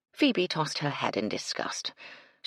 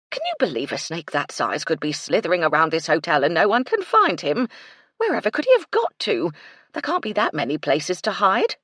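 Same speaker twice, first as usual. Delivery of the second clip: fretting housekeeper; 2 semitones higher, 7 dB louder than usual. Acted. Mrs Chubb about the missing snake, louder fretful. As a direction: fuss in rising alarm.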